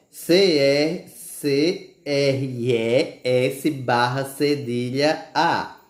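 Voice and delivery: very slow, letters drawn out one by one; slow spelling. Shown as a demonstration. Teacher spelling cresça very slowly. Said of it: "Cresça".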